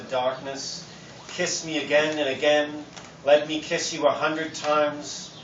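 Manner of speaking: deliberate, measured love-poem recital; poetry reading. Acted. Poet reads Catullus kissing poem to audience, measured recital. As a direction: recite it in drawn-out, even phrases.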